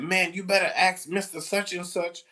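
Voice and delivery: student defying the teacher, high indignant voice; defiant student. Retold or imitated. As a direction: imitate a defiant teen. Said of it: "he said".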